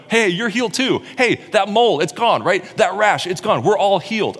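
high, excited back-and-forth among friends; excited healed friends; excited buddies. Retold or imitated. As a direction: point at each other, thrilled. Buddies point out each other's healing.

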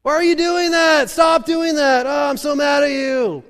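high, full-force angry yelling; yelling parent. Acted. Parent yelling at kids to stop, furious shout. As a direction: scream at the kids, totally losing it. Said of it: "you just yell at them".

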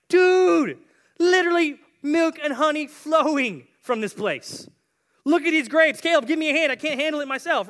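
high, loud, wildly excited; amazed spy. Acted. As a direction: marvel loudly like an overexcited buddy. Acting out amazed spies seeing the land.

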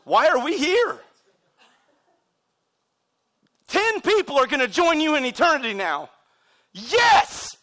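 high, loud preacher's exclamation; impassioned shout. Real, not acted. Preacher rallying congregation over new converts, high exultant shout. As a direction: shout it out to rouse the room.

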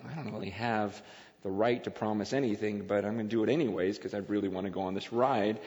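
low, soft inner monologue; private thought. Retold or imitated. Dad doubting his promise before the ride, quietly to himself. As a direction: think it aloud, low and to yourself.

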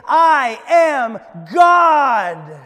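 loud, drawn-out shout, pitch up; top-of-the-lungs scream. Acted. Guru showing Shirley the declaration to scream, loud slow shout. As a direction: scream it out slowly, full force. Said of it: "scream at the top of your lungs".

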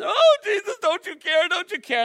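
high, loud panicky cry of the disciples; panicked disciples. Acted. Disciples crying out to sleeping Jesus, high panicked plea. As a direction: wail it like frightened men in a storm.

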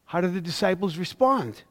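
ordinary talk, nothing marked

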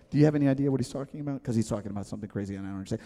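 fast whispered sidebar. Retold or imitated. Confused listeners muttering to each other, fast hushed aside. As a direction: rush it like a nervous side chat. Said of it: "sidebar discussions".

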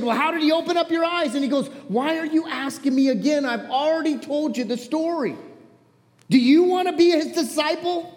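high, exasperated back-and-forth; passionate retelling. Acted. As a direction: push back, fed up with the questions. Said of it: "I was passionate".

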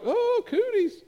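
very high, childish mock squeal; squealing second grader. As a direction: recoil like a kid dodging germs. Second grader recoiling from cooties.

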